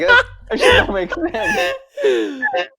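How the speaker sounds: high, slow, laughing disbelief. Retold or imitated. Laughing disbelief in retold quote.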